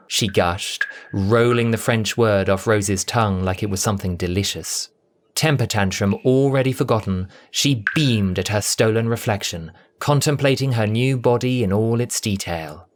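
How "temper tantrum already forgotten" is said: usual speaking voice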